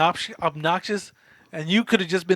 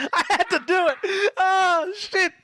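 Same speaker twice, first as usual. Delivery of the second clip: laughing through the words; cracking up mid-line; 11 semitones higher, slower than usual. Real, not acted. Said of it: "I'ma fucking die".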